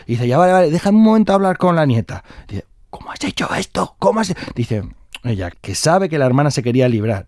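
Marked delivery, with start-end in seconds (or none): 0.3-2.2 high, loud brush-off; impatient sister. Acted. The sister wants a word with the bride, high and impatient. 2.9-4.3 high, half-whispered demand; urgent disbelief. Acted. The sister privately quizzes the bride, urgent half-whispered disbelief.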